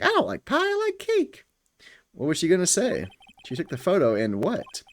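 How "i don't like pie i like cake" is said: much higher silly voice insisting on cake; mock witness. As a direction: squeak it out like a childish witness. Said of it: "She's like".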